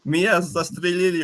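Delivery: high mock cry of a shot soldier; dying Russian soldier. Host imitating a shot game character. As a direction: groan it like a hit game extra. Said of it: "actual Russian voice actors".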